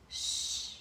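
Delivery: hushed hissing shh; sh sound. Shown as a demonstration. Teacher showing the sh sound to children. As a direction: hush out the sh. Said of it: "end with the S H sound".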